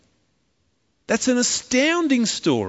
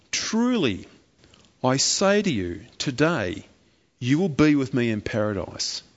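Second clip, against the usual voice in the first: calm promise; 7 semitones lower than usual. Acted. Jesus answers the criminal.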